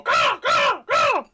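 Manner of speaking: high shrieking bird caw; shrill screeching bird woman. Acted. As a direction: screech it out like a crow. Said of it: "and I go".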